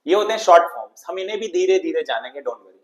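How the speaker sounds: normal talking voice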